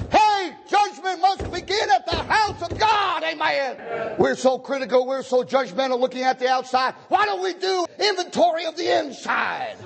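high, impassioned pulpit shout; high-pitched revival exhortation; full-force preaching. Real, not acted. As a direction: cry the warning out to the church.

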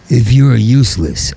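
ordinary talk, nothing marked

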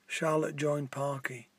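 usual speaking voice

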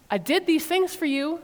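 a touch of hurt anger; teacher showing how God might sound, mildly angry; a little angry. Shown as a demonstration. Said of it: "God sounds a little angry".

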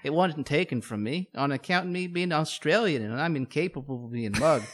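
jokey put-on Aussie con man; mock Australian. Co-host mocking a charming Aussie scammer, mock Australian accent. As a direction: ham up an Aussie charmer asking for cash. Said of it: "I'd just be like".

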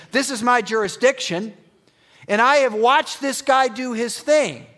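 high, loud Roman officer pleading his case; fervent centurion testimony; impassioned centurion. Acted. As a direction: speak as a proud soldier who has seen it all. Centurion voiced passionately.

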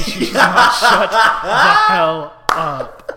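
loud, high, laughing outburst; hammed-up fed-up inner rant, dragged out; mock exasperation. Acted exasperated inner voice of Laura.

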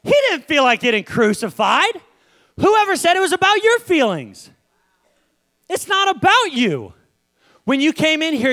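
high-pitched, fired-up preacher challenge; forceful preaching. Acted. Preacher telling church it's not about feelings, forceful. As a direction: drive it home to the congregation.

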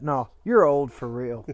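usual speaking voice

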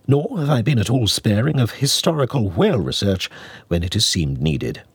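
usual speaking voice

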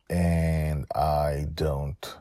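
usual speaking voice

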